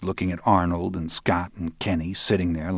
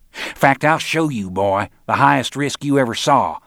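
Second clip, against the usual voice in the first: raised, louder menacing promise; menacing threat; 5 semitones higher, 5 dB louder than usual. Acted. Roland drags the boy to the balcony, raised menacing voice. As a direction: bear down on him with the threat.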